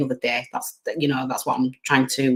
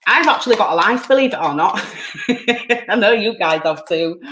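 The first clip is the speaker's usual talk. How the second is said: higher-pitched teasing aside breaking into a laugh; joking, laughing; 5 semitones higher than usual. Real, not acted. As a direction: joke with the viewers and laugh.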